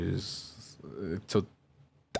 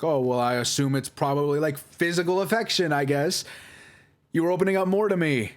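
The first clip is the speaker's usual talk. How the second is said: higher, teasing snark, faster near the end; playful retold snark; snarky; 8 semitones higher, 3 dB louder than usual. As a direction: needle her with a smug lilt. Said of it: "I was snarky a bit".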